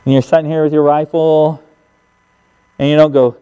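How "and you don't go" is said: ordinary talk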